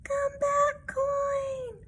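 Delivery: very high squeaky call; high kitten crying out; calling after the coin. Acted. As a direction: shout after it in a tiny voice. Kitten chasing rolling coin.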